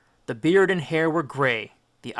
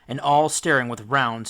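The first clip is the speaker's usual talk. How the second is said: plain reading voice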